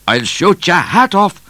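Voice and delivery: high, loud threatening shout. A narrator's reading. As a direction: shout it at a fleeing rider. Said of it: "shouted O'Grady".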